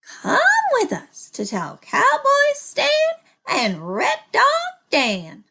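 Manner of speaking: high, loud excited call; hollered. A narrator's reading. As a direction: call out urgently to the others. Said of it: "hollered Mary".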